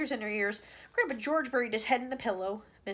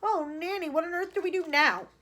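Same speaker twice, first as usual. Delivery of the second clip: helpless plea; 6 semitones higher, 5 dB louder than usual. A narrator's reading. President pleading with nanny.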